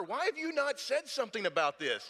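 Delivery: high-pitched exasperated mock accusation; frustrated blaming. Husband tries to put it on his wife, frustrated blaming. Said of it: "I tried to put it on her".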